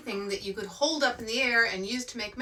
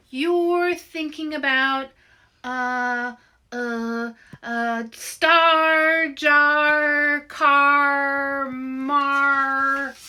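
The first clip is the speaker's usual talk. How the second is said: mystic straining; 5 semitones higher, 10 dB louder, much slower than usual. Acted. Louise strains to read mind.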